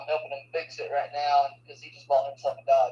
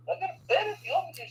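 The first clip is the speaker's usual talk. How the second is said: mock sleepy self; 13 semitones higher, faster than usual. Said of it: "And I'm like".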